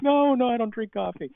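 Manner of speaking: nervous reply. Retold or imitated. Nervous young self replying.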